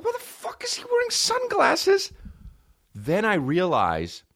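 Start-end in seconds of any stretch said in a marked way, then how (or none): 0.0-2.1 high, disbelieving squeal; incredulous. Retold or imitated. Comic baffled by the actor's sunglasses, high squeal. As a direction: pitch way up in disbelief.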